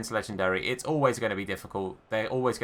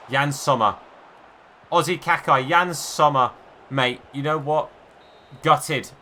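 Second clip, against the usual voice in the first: excited praise; 3 semitones higher, 6 dB louder than usual. Real, not acted. Celebrating late saves.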